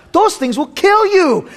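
hammed-up warning about avocados; mock alarm. Joking about avocado fat.

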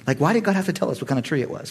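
ordinary talk

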